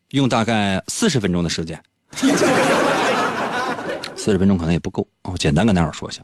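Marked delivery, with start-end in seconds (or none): 2.1-4.1 high, breathy burst of laughter; laughing. Real, not acted. Host laughing at his own teasing, real laugh. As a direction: laugh at your own joke.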